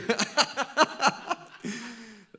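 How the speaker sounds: loud, high burst of laughter; big laugh. Real, not acted. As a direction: crack up at your own confusion. Laughs at own confusion.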